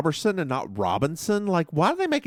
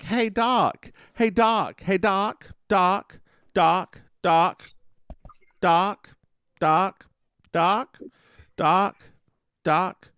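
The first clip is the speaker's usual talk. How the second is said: calling out; 6 semitones higher, 3 dB louder, much slower than usual. Acted. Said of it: "She's like".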